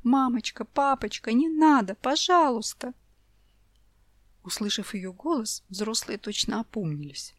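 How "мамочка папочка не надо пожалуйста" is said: higher, louder tearful plea; a frightened girl begging; sobbing child. A narrator's reading. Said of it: "рыдая и лепеча". (ребёнок рыдает и умоляет)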